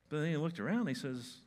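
ordinary talk, nothing marked

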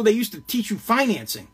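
ordinary delivery

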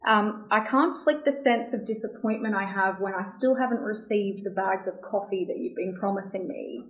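usual speaking voice

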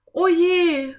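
high, loud cry of dismay. A narrator's reading. Anne noticing water dripping from above, dismayed cry. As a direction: exclaim in sudden worry. Anne sees the cracked ceiling.

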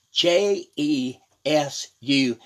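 slow, emphatic letter-by-letter spelling; deliberate preacher's spelling, letter after letter; spelled out slowly. Real, not acted. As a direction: spell it out, giving each letter its own beat.

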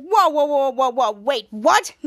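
acted-out startled confusion; confused. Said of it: "he was so confused".

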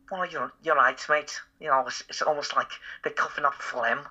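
high, phlegmy mock Scouse; Liverpool accent. Shown as a demonstration. Showing how Liverpool talks, exaggerated Scouse accent. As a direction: put on Scouse as if coughing up phlegm. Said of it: "their accent over there is".

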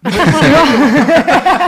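high burst of laughter; loud laughter. Real, not acted.

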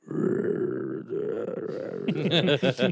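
long rolled zombie growl; imitated zombie noise. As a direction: imitate a zombie's drawn-out rattle. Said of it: "constantemente estás escuchando el". (gruñido de zombi imitado)

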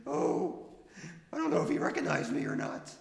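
put-on high, eager kid voice; squeaky young burger worker; high-voiced nervous teen. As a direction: chatter like a jittery fast food kid. Holds Billy Bopper voice.

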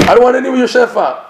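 high, quick and laughing; can't hold back a laugh while quoting; storyteller laughing as he voices the Rebbe; laughing through the quote. Retold or imitated.

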